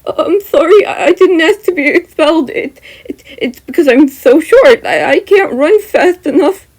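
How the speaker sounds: high, loud, stammering panic; terrified stammer. Acted. Mineta begs the menacing figure, high terrified stammer. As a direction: stumble over words in fear. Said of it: "Mineta stammers, clearly terrified".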